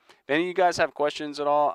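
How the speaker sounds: usual speaking voice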